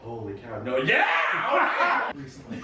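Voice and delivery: high shocked laughter; surprised laughing exclamations. Real, not acted. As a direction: gasp and laugh in disbelief. Surprised by audience story.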